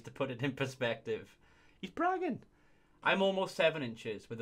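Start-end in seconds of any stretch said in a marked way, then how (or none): none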